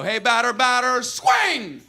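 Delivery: high chant with long drawn-out SWING; yelled chatter. Retold or imitated. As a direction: lean in and heckle the batter loudly. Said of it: "when he yelled that".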